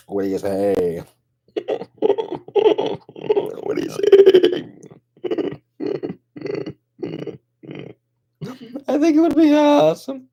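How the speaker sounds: very high, loud squeaky come-on with giggles; high puppet flirt. Acted.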